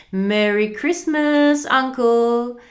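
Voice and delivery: high, loud cheerful greeting; merry, ringing storybook voice; happy cry. A narrator's reading. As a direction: call it out happily to your uncle. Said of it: "cried a happy voice".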